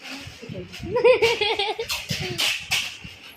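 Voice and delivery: drawn-out teasing laugh; laughing after teasing her mother; long laugh. Real, not acted. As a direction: laugh long at mom's expense.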